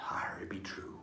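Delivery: hushed, breathy pirate "arr" in a storyteller's whisper. Acted. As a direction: lean in and whisper it like a spooky pirate secret. Pirate storyteller drops to hushed tale.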